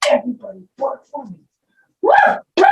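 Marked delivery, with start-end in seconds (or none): none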